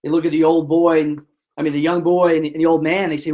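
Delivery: ordinary delivery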